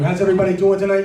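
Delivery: loud, fast, laughing greeting; crowd hype shout. Real, not acted. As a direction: fire up the crowd. MC hypes the crowd.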